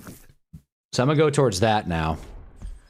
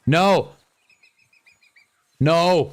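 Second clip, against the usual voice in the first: startled shout; 8 semitones higher, 4 dB louder than usual. Real, not acted. Deer runs off, cries out.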